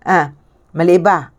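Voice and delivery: ordinary delivery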